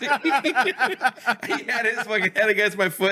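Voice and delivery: laughing through the words, high and slow; can barely get it out for laughing; giggling disbelief while describing it. Real, not acted. Laughing while telling goat story.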